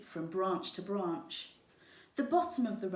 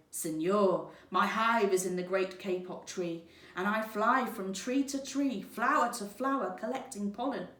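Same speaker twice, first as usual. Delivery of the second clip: buzzing bee; 3 semitones higher, 5 dB louder than usual. Acted. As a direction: buzz it out like an eager little bee. Bee character speaks.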